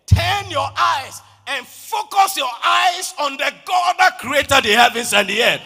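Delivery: roaring pulpit declaration; full-force preaching. Acted. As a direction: preach it at the top of your voice.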